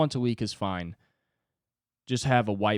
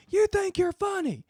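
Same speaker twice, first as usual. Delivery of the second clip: tough coach. Pretend. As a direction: berate him like a drill sergeant coach. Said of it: "a guy hovering over me just being like".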